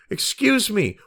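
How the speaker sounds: ordinary talk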